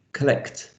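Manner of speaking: collect with a crisp k and t at the end; collect as a teaching example, ending in an audible k-t; final consonants demonstrated. Shown as a demonstration. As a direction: say collect on its own, sounding both final consonants. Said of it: "you've got a k and a t at the end".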